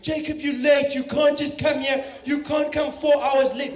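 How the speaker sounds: hammed-up angry Helen Zille, loud and high; angry politician. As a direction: scold him like an angry MP. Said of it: "Helen Zille ... losing it".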